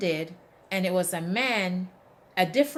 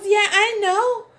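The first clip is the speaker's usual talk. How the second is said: put-on high, loud child's voice; little daughter answering a compliment, high and sure; confident little girl; 13 semitones higher, 11 dB louder than usual. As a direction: play a self-assured little girl. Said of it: "she goes".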